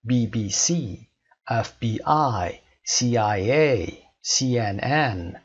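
slow letter by letter, last letter hit; «سی» در «بی بی سی» stressed at the end; final letter stressed. Shown as a demonstration. (تلفظ آهسته با تکیه روی حرف آخر)

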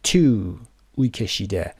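normal talking voice

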